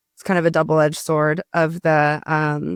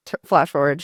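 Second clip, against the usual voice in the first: ordinary talk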